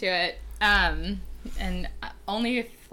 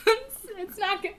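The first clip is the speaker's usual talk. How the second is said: ordinary delivery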